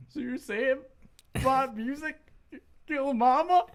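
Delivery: high-pitched, halting put-on voice; movie character. Imitating son asking about mama's death, high and halting. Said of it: "Yeah, he's like".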